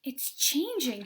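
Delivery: loud, slow cry of wonder; excited shout. Acted. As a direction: call out thrilled. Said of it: "shouted Violet".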